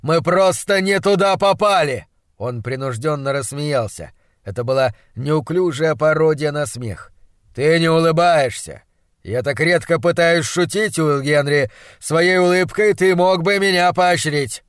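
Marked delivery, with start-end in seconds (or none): 0.0-2.1 higher, louder protest; insistent. A narrator's reading. Doctor denying they are lost, insistent. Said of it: "настаивал он". 7.5-14.6 louder, emphatic doctor voice; reproachful, jocular monstrologist. Acted. Doctor whose joke fell flat to his assistant, reproachful.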